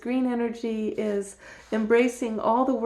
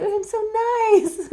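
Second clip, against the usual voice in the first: mock sweet; 9 semitones higher, 4 dB louder than usual. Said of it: "That's being nice".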